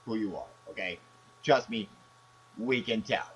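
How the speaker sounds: ordinary delivery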